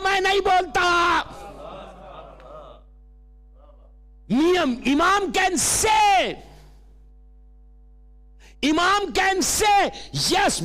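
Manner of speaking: high, ringing sermon voice; preaching. Acted. Preacher telling the congregation only the Imam may say "I", preaching. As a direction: drive it home with long-held line endings.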